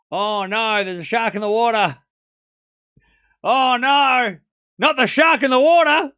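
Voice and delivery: loud, high, hammed-up Aussie alarm; mock panic in a broad Australian accent. Shown as a demonstration. Crying shark for chat, mock-alarmed Australian accent. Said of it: "with a believable Australian accent".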